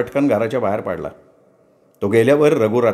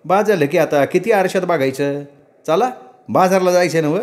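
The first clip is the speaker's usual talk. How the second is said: higher, impatient village woman's voice; young wife Ratna; 6 semitones higher than usual. Acted. Wife Ratna hurrying her husband to market, impatient. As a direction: nag him to stop preening.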